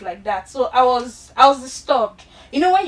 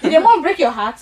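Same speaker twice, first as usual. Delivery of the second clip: sarcastic mom; 7 dB louder than usual. Retold or imitated. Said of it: "My mom is very sarcastic".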